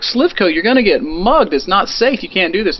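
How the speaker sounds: high, urgent warning to a friend; alarmed warning. Retold or imitated. Friends begging Slivko not to walk alone at night, high urgent warning. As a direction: plead with him not to go.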